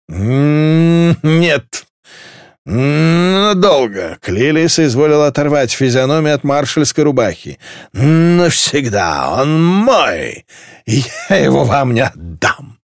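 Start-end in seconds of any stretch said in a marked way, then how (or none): 0.1-4.2 high, long hummed drawl; drunken stubbornness. A narrator's reading. Drunk girl corrects the departing marshal, drawling high. 7.9-12.8 cling to every stretched word; drunken possessiveness. A narrator's reading. Drunk girl claims the marshal, drawling possessively.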